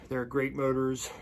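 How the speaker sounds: ordinary delivery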